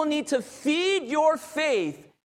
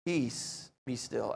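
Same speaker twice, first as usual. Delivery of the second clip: very soft, low, calming command; 11 semitones lower, 11 dB quieter, slower than usual. Retold or imitated. Jesus answering the cry for help, calm. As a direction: hush the storm gently, dropping low. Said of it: "He calmly gets up and he says".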